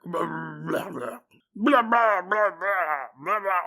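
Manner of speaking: wet, sloppy barks; slobbery bulldog bark. Shown as a demonstration. Imitating a bulldog barking all night, slobbery. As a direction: bark through loose jowls. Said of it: "a very slobbery bark".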